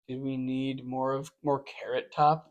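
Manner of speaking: soft, slow, unimpressed question; a deadpan groan at the show; mock weary viewer. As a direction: sigh it out, unimpressed.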